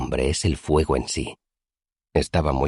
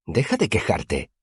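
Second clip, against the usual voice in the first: snapped; 6 semitones higher than usual. A narrator's reading. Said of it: "me espetó Simón".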